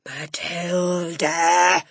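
slow, drawn-out loud roar of the name. Acted. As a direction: call her out like a thunderclap. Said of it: "she roared".

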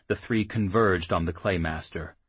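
plain reading voice

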